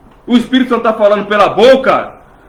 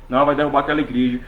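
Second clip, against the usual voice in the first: mock scheming mutter; 7 semitones lower, 7 dB quieter, much faster than usual. Mimics scheming authorities.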